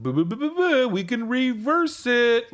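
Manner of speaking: sarcastic high mock voice; mocking singsong. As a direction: taunt the plot twist like a kid. Mocking game's plot twist.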